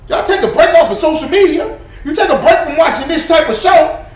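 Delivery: furious lecture; angry shout. Real, not acted. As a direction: bark the advice in frustration. Continues shouting rant.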